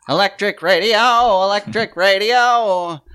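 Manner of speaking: nineteen-twenties bullhorn barker; old bullhorn voice. Retold or imitated. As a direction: call it out through an old bullhorn. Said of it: "the old bullhorn".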